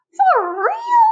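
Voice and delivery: very high, loud disbelief; a bug who can't believe he gets wings; squeaky gasp of wonder; amazed caterpillar. Acted.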